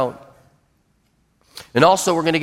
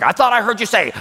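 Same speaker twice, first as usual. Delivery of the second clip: higher, confrontational challenge; pressing; 5 semitones higher, faster than usual. Acted. Boss pressing an employee who muttered, confrontational. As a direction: press them with a raised pitch. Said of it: "don't have to press them".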